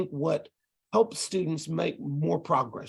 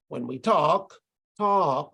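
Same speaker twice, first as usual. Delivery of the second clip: "When we talk" with the voice dropping on "talk", then "talk" repeated falling; falling intonation; slower than usual. Shown as a demonstration. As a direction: let "talk" glide down to close the group. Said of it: "our voice falls at the end".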